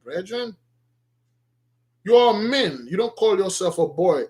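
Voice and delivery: ordinary talk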